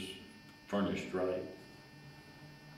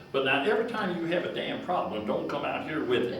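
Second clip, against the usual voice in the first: put-on higher and louder, an irritated old farmer telling him off; gruff scolding; 7 semitones higher, 3 dB louder than usual. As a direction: scold him like a grumpy boss. Gruff boss repeats warning.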